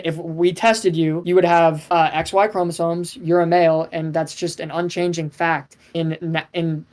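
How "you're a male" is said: ordinary talk, nothing marked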